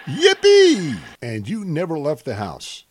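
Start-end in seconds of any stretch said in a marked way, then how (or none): 0.1-1.0 loud, high sarcastic yippee; mock cheer.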